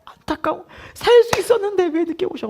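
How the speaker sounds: acted lament, sighing then high-pitched; hushed, pained sigh swelling into a high mock wail; grieving sympathy. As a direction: lament along with the mourners, voice rising high. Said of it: "막 같이 슬픈 거예요".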